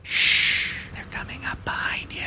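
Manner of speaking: breathy whisper in your ear; asmr whisper. Pretend. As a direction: whisper it close and creepy. ASMR joke about creeping sounds.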